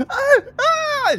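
high loud panicked crying out; girl's frightened cry. Acted. GM voicing the girl crying in panic. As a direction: cry out like a scared child. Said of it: "She says".

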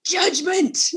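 shouted high mock accusation. Cohost teasing her friend with a shouted accusation. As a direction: fling the word at her in jest.

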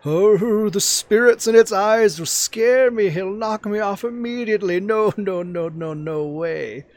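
high, slow, fretful turtle voice; a nervous giant turtle shying away from horses; timid old turtle. Retold or imitated. Imitating the turtle for friends, high and scared. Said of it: "Can you imitate it as best as possible?".

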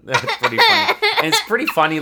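laughing hard, loud; cracking up mid-sentence. Real, not acted. Laughing at funny game deaths.

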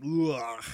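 guttural ugh from the back of the throat; throaty "ugh". Shown as a demonstration. Teacher showing where the R sits, guttural ugh. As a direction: scrape the uvula with an ugh. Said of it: "back of your tongue kinda reaches up".

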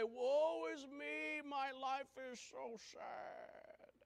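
slow, hammed-up woe; mocking moaning complaint; mock self-pity. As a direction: drag out a whiny lament. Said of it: "don't call everybody and say".

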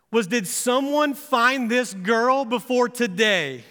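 a sermon rising in passion; climbing fervent sermon voice, ending urgent; building to full-force preaching. Acted. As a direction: preach it harder line by line until it peaks. Sermon builds to an altar-call climax.

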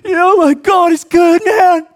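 high, slow, tearful mock sob of a crying athlete; imitating crying. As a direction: ham up a weepy, choked-up thank-you. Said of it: "So he's just like".

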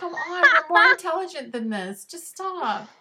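high-pitched, hammed-up exasperation; exasperated protest. Acting out exasperation at bad script.

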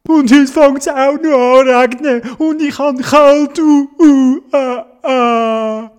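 high, loud mock bawling; hammed-up high tearful whine; crying. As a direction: wail the complaint and end in boo-hoo sobs. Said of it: "dass er angefangen hat zu brieken".

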